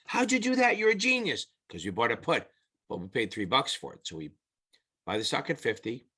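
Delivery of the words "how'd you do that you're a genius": high-pitched gushing customer; exaggerated admiration, pitched way up; mock amazement. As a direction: play an astonished, grateful client. Acted customer's amazed reply to broker.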